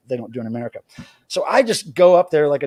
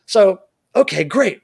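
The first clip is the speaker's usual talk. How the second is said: normal talking voice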